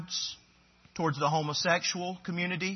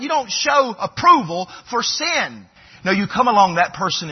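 loud, high, driving sermon voice; forceful preaching; 6 semitones higher, 10 dB louder than usual. Acted.

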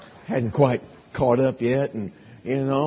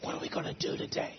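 unvoiced secretive whisper; 14 dB quieter, faster than usual. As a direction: whisper it to a friend. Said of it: "guys whispering to each other".

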